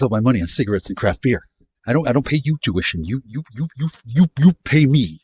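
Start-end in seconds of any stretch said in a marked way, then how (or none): none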